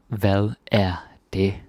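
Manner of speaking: "Hvad er det?" slowed down, words spaced; slow teaching repeat. Shown as a demonstration. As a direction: say it slowly for the class.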